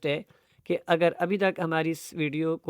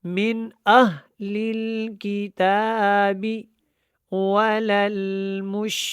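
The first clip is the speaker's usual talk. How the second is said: slow, measured chant with held vowels; teacher's model tajweed reading; Quran recitation; 2 semitones higher, 3 dB louder, much slower than usual. Shown as a demonstration. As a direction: recite deliberately, stretching the long syllables. Teacher demonstrates careful Quran recitation.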